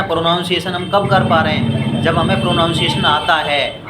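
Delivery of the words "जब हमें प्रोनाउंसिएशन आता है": usual speaking voice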